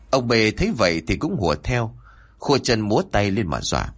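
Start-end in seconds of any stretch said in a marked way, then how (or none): none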